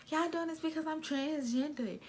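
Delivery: high whiny mock complaint; mocking Cindy. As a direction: mimic her accusing tone. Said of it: "Kept saying".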